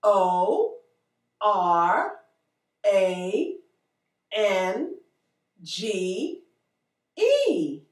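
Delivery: each letter slow and separate; spelling it out. Shown as a demonstration. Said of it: "spelling out letters for children".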